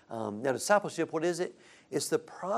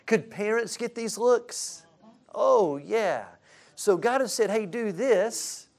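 higher, louder and slower; animated; 9 semitones higher, 6 dB louder, slower than usual. Real, not acted. Pastor to the congregation, animated and high. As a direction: rouse the parents.

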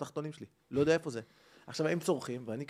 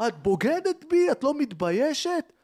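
high, loud, slow outraged shout; betrayed man screaming at his girlfriend, slow and furious; furious yell; 10 semitones higher, 7 dB louder, slower than usual. Retold or imitated. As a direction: scream it at the cheating girlfriend, wounded and livid. Betrayed lover yelling at girlfriend.

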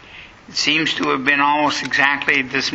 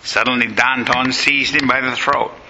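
ordinary delivery

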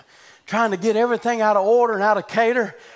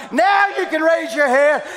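raised with a laugh; louder, laughing; 7 semitones higher, 5 dB louder than usual. Real, not acted. Preacher telling the woman again to lift her head, louder.

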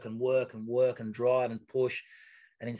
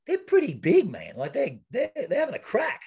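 much higher, louder incredulous insistence; amazed insistence; 11 semitones higher, 5 dB louder than usual. Retold or imitated. Agent telling him how big Under Armour is, amazed and insistent. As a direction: tell him like he's crazy not to know.

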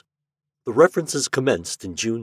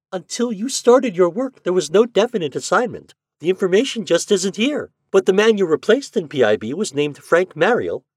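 put-on a much higher, bright, friendly young woman; young clerk girl; 12 semitones higher, 7 dB louder than usual. A narrator's reading. PIB clerk greets Shandor on video, friendly young woman. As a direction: greet him like a cheerful office clerk.

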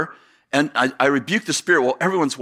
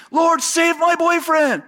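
high, slow mock scream; imitated screaming; 13 semitones higher, slower than usual. Preacher imitating a girlfriend pleading for her boyfriend, mock scream. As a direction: draw out a high-pitched imitation cry. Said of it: "starts screaming".

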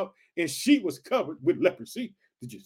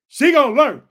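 loud, higher, firm declaration; stern God voice; 6 semitones higher, 9 dB louder than usual. Acted. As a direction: lay down God's lesson. Preacher voicing God, firm.

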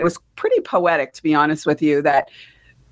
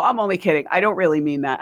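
ordinary talk, nothing marked

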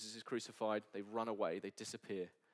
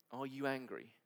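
ordinary talk

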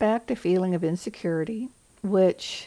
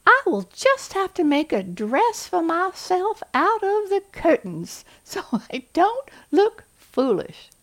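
much higher, louder Southern belle accent; an over-the-top Southern belle accent, slowing at the end; Scarlett O'Hara; 11 semitones higher, 4 dB louder than usual. Shown as a demonstration. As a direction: gush it in a Southern belle accent like a silly movie heroine. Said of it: "I love Scarlett O'Hara".

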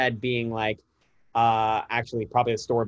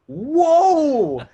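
put-on amazed kid whoa; 14 semitones higher, 7 dB louder than usual. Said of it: "And they're just like".

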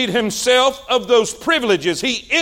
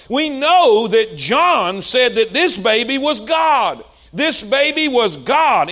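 ordinary talk, nothing marked

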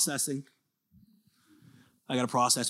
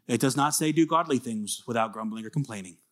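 normal talking voice

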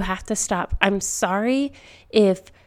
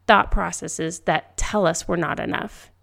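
ordinary delivery

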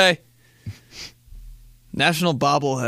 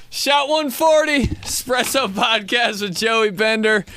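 podcast outro; 7 semitones higher than usual. Acted. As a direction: announce the outro with energy. Outro ad read.

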